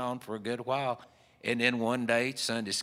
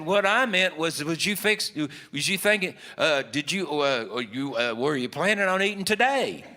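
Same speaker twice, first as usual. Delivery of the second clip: high, loud, fumbling restarts; flustered stammer; 6 semitones higher, 6 dB louder than usual. Retold or imitated. Fella trying to ask her out, flustered and stammering. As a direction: imitate a suitor tripping over his question. Nervous suitor fumbles his invitation.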